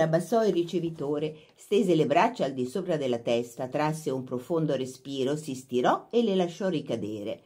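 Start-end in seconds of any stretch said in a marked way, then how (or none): none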